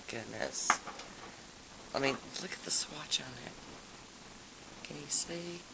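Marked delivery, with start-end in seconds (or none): 1.9-5.6 whisper. Real, not acted. Showing polish swatch close to camera.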